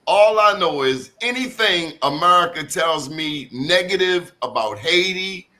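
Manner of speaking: usual speaking voice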